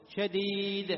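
loud, sustained شديد with a quick bounce on the final d; qalqala on dal. Shown as a demonstration. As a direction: say شديد as "shadiiid", d popped short. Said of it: "تخفف شدته بالقلقلة".

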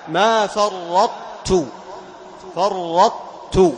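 فرطت very slow, ط merged into ت keeping its heaviness; drawn-out فرطت with ط assimilated into ت; slow, emphatic فرطت; merged taa. Shown as a demonstration. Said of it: "تقلقل الطاء ثم تأتي بالتاء".

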